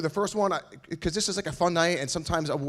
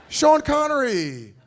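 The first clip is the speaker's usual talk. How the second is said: much higher, slow hammed-up Scottish accent; Sean Connery Scottish accent; 12 semitones higher, 8 dB louder, much slower than usual. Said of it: "He's Scottish and he talks like that".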